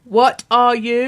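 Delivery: "What are you?" drawn out slowly, word by word; deliberate prompting. Acted. Host pressing co-host for his title, slow deliberate prompting.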